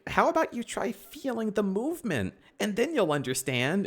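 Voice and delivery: high, airy mock-wise Aang. Aang coaching Katara on waterbending, high sing-song. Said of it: "And then Aang is like".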